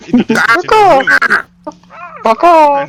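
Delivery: very loud, high cawing; crow caws. Acted. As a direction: imitate a crow's harsh call at full volume. Imitating distant crows cawing.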